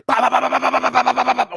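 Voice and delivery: loud, high rapid "bah bah bah" imitating punches; mock punch noises. Retelling the fight to friends, shouting punch sounds high and loud. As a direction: belt out the punch sounds like a rapid-fire cartoon beatdown. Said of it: "He's like".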